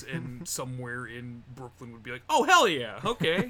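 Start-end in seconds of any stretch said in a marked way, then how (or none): 2.3-3.5 high, loud hell yeah; excited cheer. Retold or imitated. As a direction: cheer like a thrilled fan. Fans greeting the Jamaican character.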